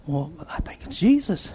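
hushed whisper to Peter; John whispering to Peter who the man is. Acted. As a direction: breathe it like a secret. John confides quietly.

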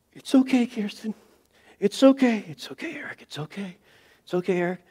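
high, breathy panic telling himself it's fine; wavering high voice, trying not to lose it; panicked self-reassurance. Retold or imitated. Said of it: "And I'm just like".

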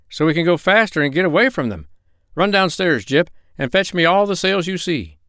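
high, pressing storybook character voice; urgent doctor. Acted.

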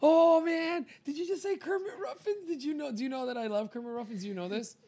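overjoyed at a shared favourite; thrilled. Real, not acted. Hearing a friend name his favourite artist, squealing high.

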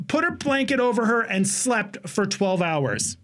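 ordinary delivery

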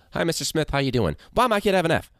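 high, pushy complaining parent; whiny parent. Retold or imitated. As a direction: pitch it up like an upset parent. Parent voiced in imagined conference.

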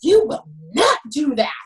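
retold yell. Coach to athlete throwing a water bottle, retold yell. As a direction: re-enact a coach yelling a firm order. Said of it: "And I yell, typical [name] fashion".

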